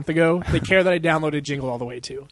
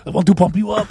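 a hint of an Arnold voice, louder; lightly voiced Arnold; 3 semitones higher, 6 dB louder than usual. Arnold speaking to Kyle, a lightly put-on louder voice. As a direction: pump it out like a bodybuilder.

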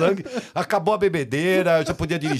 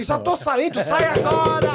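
much higher, frantic mock shout; hammed-up panic through the door; frantic mock yell, pitched high; panicked exchange; 12 semitones higher, slower than usual. Retold panicked exchange in bathroom.

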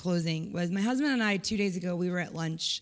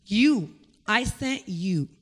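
slow and louder, each word weighed; slow solemn emphasis; 6 dB louder, much slower than usual. Retold or imitated. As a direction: land it slowly, pointing at them. God answers in her story.

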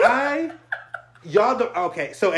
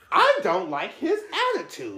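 mock whiny girl voice; 11 semitones higher than usual. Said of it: "turns to Terrell and says".

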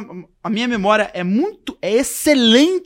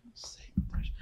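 very soft, unvoiced aside; hushed dismay; helpless whisper; 16 dB quieter than usual. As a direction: whisper it as if lost. Muttering while facing a person.

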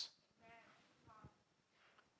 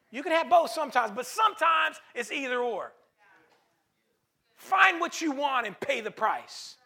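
louder and higher delivery; preaching full voice; 4 semitones higher, 6 dB louder than usual. Acted. Preacher urging listeners to pay price, loud. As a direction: drive it home.

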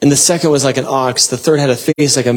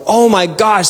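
higher-pitched, amazed outburst; awestruck cry; 7 semitones higher than usual. Acted. Angels getting this revelation of God, crying out in awe. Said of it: "what makes the angels cry out".

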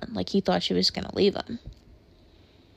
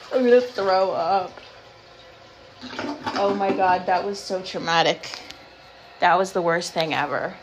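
louder, rattled exclamations after a fright; real post-scare yelling; shaken outburst; 4 dB louder than usual. Real, not acted. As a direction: blurt it out, still shaken. Aftermath of catching spider.

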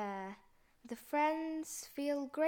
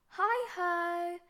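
bright high greeting; cheerful call; 7 semitones higher, 4 dB louder than usual. Acted. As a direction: call out happily. Hare calls out greeting.

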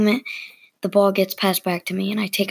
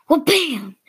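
high, punchy exclamation; sudden impact; 7 semitones higher than usual. A narrator's reading. Ball flying right by the player's head, sudden exclamation. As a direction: punch out the bam as a sudden surprise.

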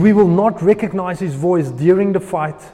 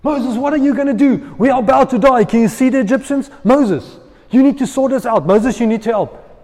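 voice of reasoning; 6 semitones higher, 5 dB louder than usual. Acted. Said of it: "the voice of reasoning and reality".

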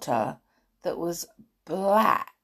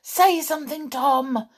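ordinary delivery

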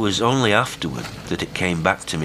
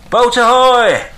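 very high, long loud hail; 12 dB louder, slower than usual. A narrator's reading. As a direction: bellow it across the sea through cupped hands. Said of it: "raised his hands to his lips and hailed".

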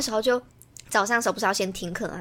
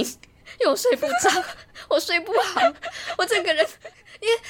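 laughing while talking; 11 semitones higher than usual. Real, not acted. Recalling a sleepless night before the test, laughing through it. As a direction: laugh through the words at your own panic.

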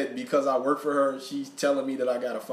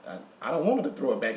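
ordinary delivery

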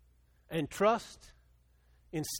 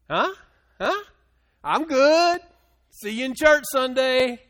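mock brush-off; 6 semitones higher, 11 dB louder, slower than usual. Mocking Tony's brush-off.

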